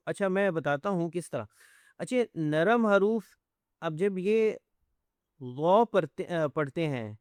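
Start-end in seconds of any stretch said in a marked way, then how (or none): none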